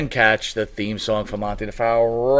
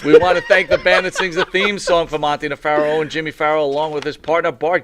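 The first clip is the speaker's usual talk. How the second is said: show announcer read; 4 semitones higher, 4 dB louder than usual. Acted. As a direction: read credits like a host. Reading show credits.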